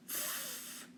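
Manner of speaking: a whispered "F-" sound, unvoiced. A narrator's reading. Child sounds out her name, whispered F-. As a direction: breathe out a soft "F-" like a child sounding out. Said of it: "Phoebe sounded out the first letter".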